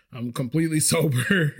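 breaking into laughter mid-denial; laughing. Real, not acted. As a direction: let a laugh overtake the words. Laughs at own retold denial.

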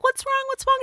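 worried mother. Retold or imitated. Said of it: "I'm like".

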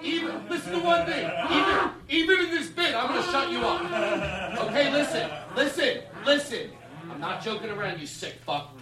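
high, slow, menacing bouncer voice; mean bouncer. Pretend. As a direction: threaten like an unhinged bouncer. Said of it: "you have to be the dick".

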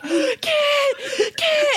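high mock-sobbing call; exaggerated tearful cry of a name; imitated sad wailing. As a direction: call out like a heartbroken boyfriend, hammed-up. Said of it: "he's so sad".

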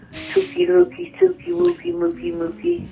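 put-on squeaky high baby talk; high cooing baby voice. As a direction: coo it like to a pet.

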